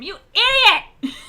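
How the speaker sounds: high, drawn-out furious scream. Acted. Player screams at a companion in the game, furious shout. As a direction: scream it at full force, stretching the words. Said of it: "first I'm gonna scream".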